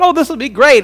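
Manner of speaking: high, delighted turtle; excited turtle. Acted. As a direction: gush with happy anticipation. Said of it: "He said".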